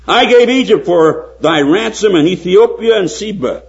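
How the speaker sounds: high, loud preaching of scripture; fervent pulpit reading. Acted. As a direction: proclaim the verse with full force, drawn out. Quoting Isaiah at full force.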